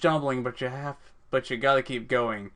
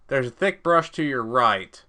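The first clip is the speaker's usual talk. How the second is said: normal talking voice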